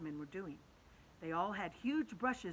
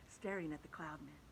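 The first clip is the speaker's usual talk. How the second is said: plain reading voice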